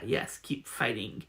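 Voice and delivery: normal talking voice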